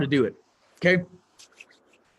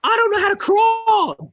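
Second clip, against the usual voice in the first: much higher, louder and slow mock-baby shout; whiny baby; 4 dB louder, slower than usual. Toddler complaining it can't crawl yet, in a high mock wail. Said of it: "It's like saying to, you know, my, my little kid".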